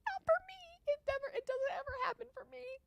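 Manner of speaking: high, whiny wail of self-pity; whining despair. Real, not acted. Bettor whining to chat that luck never comes, high and despairing.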